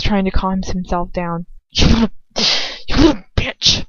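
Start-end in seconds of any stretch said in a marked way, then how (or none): 1.7-3.8 angry outburst. A narrator's reading. Lucius lashing out at her in a raised snarl. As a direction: spit the insult. Lucius erupting after slap.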